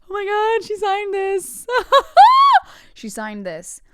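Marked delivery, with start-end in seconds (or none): 0.1-1.7 high, loud squeal of a thrilled fan; starstruck fan squeal. Retold or imitated. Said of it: "but I was like". 1.7-2.9 very high, loud hysterical laugh; fangirl shriek laugh. Retold or imitated. Fangirl shriek after the quote.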